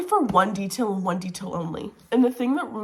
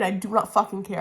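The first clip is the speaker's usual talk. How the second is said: ordinary delivery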